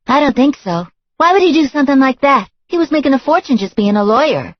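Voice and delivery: high and loud, getting huffy; a brassy woman working up to outrage; indignant girlfriend. A narrator's reading. Girlfriend threatening to call the cops, high and indignant.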